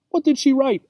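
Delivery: a hint of an eager little pet; Obi asking. A narrator's reading. Obi asks the cat eagerly, lightly voiced and curious. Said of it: "asked Obi".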